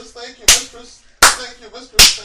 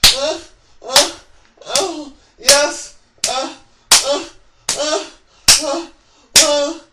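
high loud moaning grunts; loud moans; 7 semitones higher, 14 dB louder than usual. Acted. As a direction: moan hard with each blow. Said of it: "He moaned with each blow".

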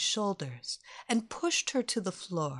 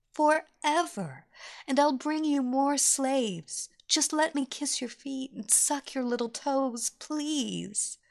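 adoring pleading slave; 5 semitones higher than usual. A narrator's reading. Kelly pleading devotedly to Evelina.

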